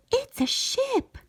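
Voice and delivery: high, breathless cry of delight; sudden excited gasp. A narrator's reading. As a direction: gasp it out, thrilled to see it. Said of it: "she gasped with delight".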